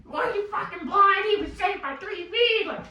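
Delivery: high, put-on squeaky kid voice; a teammate mimics the little kid's rant; mocking the short kid. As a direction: imitate the small guy yelling. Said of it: "short king comes up and goes".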